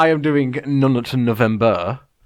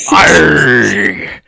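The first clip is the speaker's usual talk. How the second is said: mock scream; 5 semitones lower, 6 dB louder than usual. Acted scream in haunted house.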